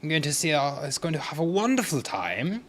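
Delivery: mock-sophisticated toff announcing his night out; posh voice. Trader boasting about the theater in a mildly posh voice. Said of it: "It's very posh".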